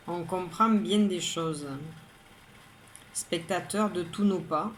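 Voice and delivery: measured poem reading, a pause after each line; poem recitation. Acted. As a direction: recite line by line, evenly and composedly. Rereads the poem aloud.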